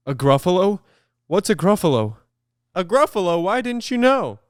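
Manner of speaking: mock-horrified animal asking about the Gruffalo; alarmed snake. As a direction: gasp it out as the startled snake.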